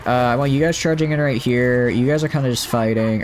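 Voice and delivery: ordinary talk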